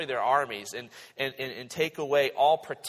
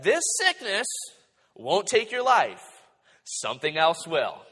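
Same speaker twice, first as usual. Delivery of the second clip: high, loud pronouncement in measured phrases; pronouncing judgment; 5 semitones higher, 5 dB louder than usual. Acted. Elisha pronounces God's judgment on the king, pronouncing solemnly. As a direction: deliver a weighty verdict, pausing between phrases. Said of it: "he pronounces God's judgment".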